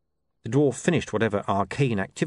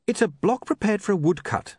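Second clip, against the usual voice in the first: higher, lightly put-on young man; William's voice; 11 semitones higher than usual. A narrator's reading. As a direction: play William higher and eager. William explaining, voiced character.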